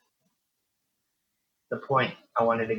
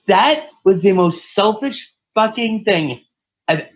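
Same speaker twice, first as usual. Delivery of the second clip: high, louder, slow furious rant; retold angry rant; 9 semitones higher, 7 dB louder, slower than usual. To the stunned family about his mom, furious rant. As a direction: lay into them, slowly and up high. Retelling outburst at grieving family.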